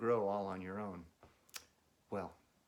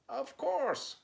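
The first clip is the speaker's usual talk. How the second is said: much higher, louder Frog; Frog's voice; 5 dB louder than usual. Acted. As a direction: exclaim it high as Frog. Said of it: "said Frog".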